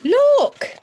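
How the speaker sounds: put-on very high, loud child's shout; a little boy pointing and crying out; small boy calling. Small boy shouts to the crowd, very high and loud. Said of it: "said a small boy".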